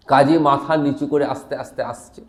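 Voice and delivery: ordinary talk, nothing marked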